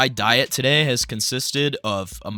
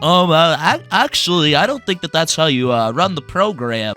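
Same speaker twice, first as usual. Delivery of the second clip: higher and louder, correcting smugly; nerdy know-it-all; 5 semitones higher, 6 dB louder than usual. Retold or imitated. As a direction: play the smug know-it-all. Mocking a know-it-all student.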